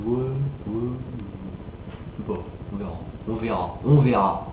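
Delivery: half-hearted, noncommittal, trailing softly; sans conviction. Shown as a demonstration. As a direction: shrug it off, hesitant pauses between phrases. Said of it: "Et pas du genre". (mou, hésitant, sans envie)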